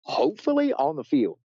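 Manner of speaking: high, giddy reply; can't hide the thrill; telling Laura where they're heading, giddy; excited. Retold or imitated. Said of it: "I was like".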